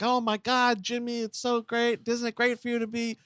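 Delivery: high, fawning press-conference voice; hammed-up sing-song praise; mock gushing reporter. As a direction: gush like an adoring reporter.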